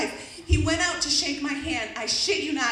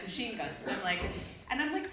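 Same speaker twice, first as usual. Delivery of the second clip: usual speaking voice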